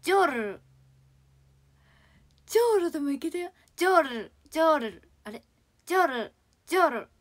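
ジョール with a trilled r; rolled r. Shown as a demonstration. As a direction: roll the r in ジョール. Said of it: "ローで終わる言葉".

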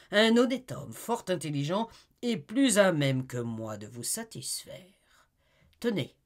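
sugary courtesy hiding contempt; oily polite husband. Acted. Husband Talleyrand brushing off the visitor, with syrupy politeness.

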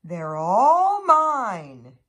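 loud, slow, drawn-out claim; possessive refusal. A narrator's reading. Mouse refuses Sparrow any berries, loud and possessive. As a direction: stretch it out loudly, guarding the berries.

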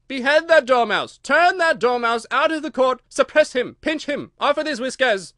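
high, very loud queenly tirade; screeching royal fury; shrieking. A narrator's reading. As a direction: shriek order after order in outrage. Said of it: "the queen shrieked out".

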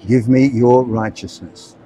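slow, measured prayer line; slow prayer lead. Shown as a demonstration. Street preacher leading girls in prayer, slow and deliberate. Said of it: "And you say, Heavenly Father".

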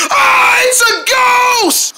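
high, loud panicked shout; frightened shout. Acted. Dog spotting the ghost, shouting high and loud in fright.

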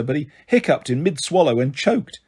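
plain reading voice

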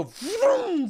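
drawn-out engine noise by mouth; car vroom. Retold or imitated. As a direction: imitate a car whipping round. Imitates car swinging round.

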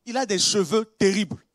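normal talking voice

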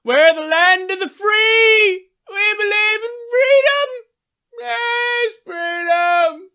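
mock patriotic fervor. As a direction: cry out about freedom. Patriotic parody, crying out.

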